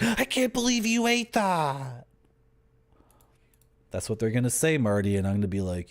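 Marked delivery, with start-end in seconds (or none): 0.0-2.1 high, put-on voice of a shocked North American; mock disgusted American. Streamer mocking North Americans shocked by his food, high put-on voice. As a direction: play the horrified foreigner. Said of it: "some North American people are like".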